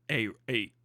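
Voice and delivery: normal talking voice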